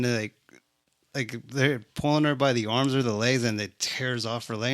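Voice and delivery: ordinary delivery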